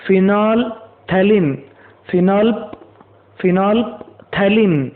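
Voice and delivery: phenolphthalein split slowly into "finol... thalin"; careful pronunciation model. Shown as a demonstration. Teacher models the word for students, phenolphthalein slowly as "finol, thalin". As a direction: draw out phenolphthalein in two parts, phenol then thalein. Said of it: "इसको phenolphthalein पढ़ा जाता है".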